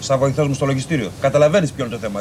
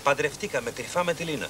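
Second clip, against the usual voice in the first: much softer, secretive repeat; hushed confession; 10 dB quieter than usual. Acted. Employee admitting secret marriage to colleague, hushed. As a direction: confess it again under your voice.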